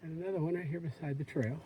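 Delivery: usual speaking voice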